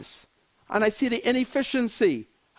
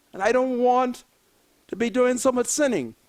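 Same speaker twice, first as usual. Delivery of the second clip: ordinary talk, nothing marked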